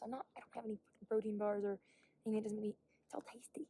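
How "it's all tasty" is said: normal talking voice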